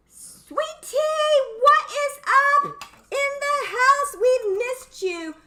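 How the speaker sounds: high, loud, slow squeal of welcome; gushing welcome. Real, not acted. Welcoming a returning viewer to the stream, squealing with delight. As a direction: greet her like a long-lost friend. Greets a viewer arriving in chat.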